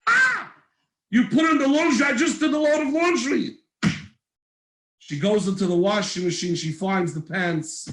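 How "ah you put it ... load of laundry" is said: a mother realizing the damage; dismayed mother. Acted.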